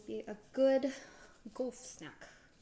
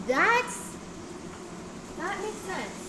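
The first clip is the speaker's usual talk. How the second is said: much higher and louder "oh"; dawning understanding; wow, that makes sense; impressed realization; 9 semitones higher, 5 dB louder, much slower than usual. Real, not acted. Hearing a fact, impressed.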